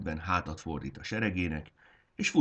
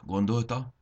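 usual speaking voice

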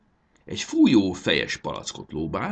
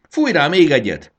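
high, loud command; urging shout; 9 semitones higher, 11 dB louder than usual. A narrator's reading. Fairy urges Marci to spray once more, shouting. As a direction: yell it to spur him on. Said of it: "kiabált a tündérlány".

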